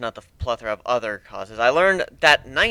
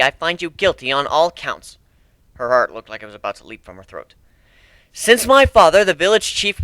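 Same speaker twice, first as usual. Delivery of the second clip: formal verdict; 5 semitones higher, 6 dB louder than usual. Acted. Cat chief delivers the verdict.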